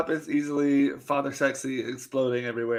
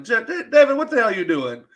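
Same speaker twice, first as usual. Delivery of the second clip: alarmed call; 7 semitones higher, 5 dB louder than usual. Retold or imitated. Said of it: "and I was like".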